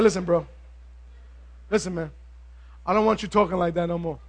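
low, hushed word taken aside; low-voiced private telling-off; confidential warning. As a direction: pull him aside and quietly set him straight. Peter takes Jesus aside privately.

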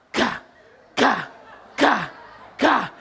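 short percussive "ka" bursts; imitated boat thuds. Comedian mimics boat hitting choppy water, imitated thuds. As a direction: punch out each wave slap separately.

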